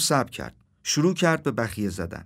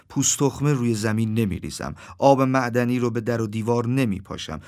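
ordinary delivery

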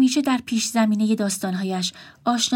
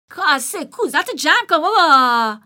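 dismissive; 7 semitones higher, 6 dB louder, slower than usual. Acted. Brother dismisses her.

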